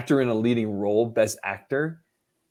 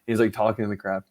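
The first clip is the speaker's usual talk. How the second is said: ordinary delivery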